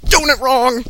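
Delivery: high, loud shriek at the text; shrieking outburst. Real, not acted. Reader shrieks at the badly written fanfic, loud. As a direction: yelp it out in disbelief.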